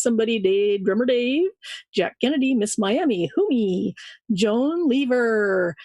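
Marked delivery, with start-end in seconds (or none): none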